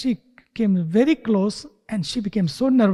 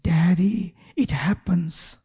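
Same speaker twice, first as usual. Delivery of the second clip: whisper; 3 semitones lower, slower than usual. Retold or imitated. Daughter calmly answers angry father, whispered. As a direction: whisper it gently, slow and accepting. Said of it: "she said".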